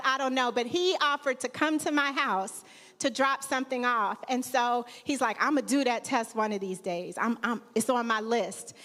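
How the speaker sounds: normal talking voice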